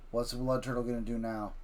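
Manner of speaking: usual speaking voice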